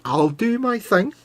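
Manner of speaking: high, slow mock Scottish huff; Scottish accent. Host plays friend's wife in a huff, mock Scottish accent. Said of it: "getting spoken to in Scottish accent".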